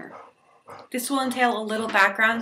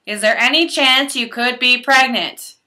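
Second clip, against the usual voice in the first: higher, much louder staff questioning; hammed-up ER questioner, loud and raised; medical history drill; 5 semitones higher, 9 dB louder than usual. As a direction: call it out like a hospital intake question. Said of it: "the medical history drill".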